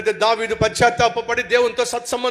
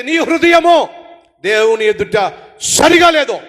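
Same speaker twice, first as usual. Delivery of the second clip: slow and loud, weight on సరిగా; solemn verdict; 7 dB louder, slower than usual. Real, not acted. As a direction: drive the verdict home slowly, hitting సరిగా. Preacher's climactic verdict.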